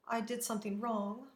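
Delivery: ordinary delivery, nothing marked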